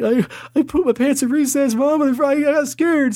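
put-on high whimpering child voice; tearful little kid. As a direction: confess like a scared kindergartner. Kid answering mom.

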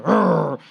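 imitated kid's sulky grumble; mock growl of a kid who is mad; grumbling growl. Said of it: "Anybody have the grumbling kid?".

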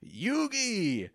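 high, strained cry of a name; hammed-up anime shout; mock anguished yell. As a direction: howl the name like a cartoon villain. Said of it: "and he says".

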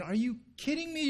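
very high incredulous question; indignant disbelief. Pretend. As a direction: protest it in mock outrage. Imagined crowd reacting in disbelief.

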